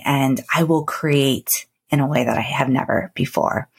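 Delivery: normal talking voice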